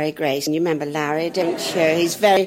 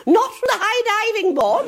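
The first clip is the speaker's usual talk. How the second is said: headmistress. Retold or imitated. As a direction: deliver the rebuke primly. Headmistress punchline.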